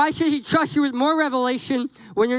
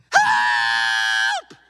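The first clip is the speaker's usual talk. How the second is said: mock cry for help; 12 semitones lower than usual.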